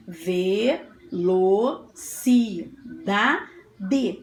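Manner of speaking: "Velocidade" very slow, five beats with pauses; syllable by syllable. Shown as a demonstration. Teacher counting mouth openings with pupils, "Velocidade" slowly separated. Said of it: "Quantas vezes eu abri a minha boquinha?".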